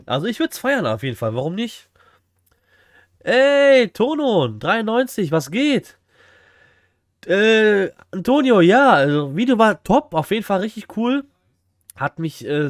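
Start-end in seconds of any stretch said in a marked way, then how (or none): 3.2-6.0 high, loud hyped greeting; begeisterte Begrüßung. Real, not acted. Begrüßt einen Zuschauer im Chat. 7.2-11.2 begeistert. Real, not acted. Begeistertes Lob an den Zuschauer.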